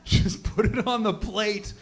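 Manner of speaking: slow, disbelieving echo; drawn-out incredulous quote; incredulous repeat. Retold or imitated. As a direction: repeat it slowly as if you can't believe it. Incredulous repeat of her reply.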